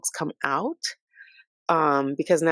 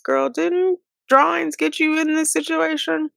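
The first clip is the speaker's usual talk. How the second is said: high, sassy rhetorical jab; 10 semitones higher than usual. Real, not acted. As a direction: call her out with a high teasing tone. Sassy aside to the inmate.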